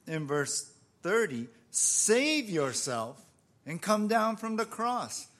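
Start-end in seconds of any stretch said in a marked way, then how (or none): 1.7-5.3 drawn-out, mildly jeering challenge; retold crowd's jeer; mocking taunt. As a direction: taunt him slowly, as if daring him. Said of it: "they're mocking him".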